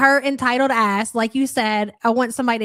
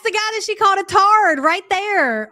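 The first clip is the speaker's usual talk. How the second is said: excited; 9 semitones higher, 4 dB louder than usual. Real, not acted. Spotting the worker she insulted.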